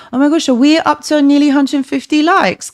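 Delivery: high and loud, thrilled to see the like count climbing; a real burst of delight at the numbers; excited. Real, not acted.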